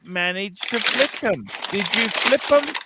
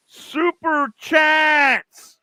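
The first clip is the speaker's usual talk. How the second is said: super chats stretched slow, high and loud; drawn-out begging call; 8 semitones higher, 4 dB louder, much slower than usual. Real, not acted. Host pleading with viewers for super chats, slow loud call. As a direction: beg for the money, slowly.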